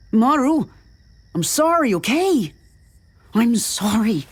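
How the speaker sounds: high, urgent apology; frantic pleading. A narrator's reading. Man apologizes to an imagined partner, frantically pleading.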